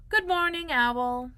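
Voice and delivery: high, loud sing-out hello; cheery greeting call. Acted. Pooh calls on Owl, in a high sing-out greeting. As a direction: sing it out on two held notes.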